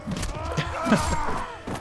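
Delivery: shrieking evil laugh; evil cackle. Acted. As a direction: cackle like a triumphant reaper. Player gloating as Death.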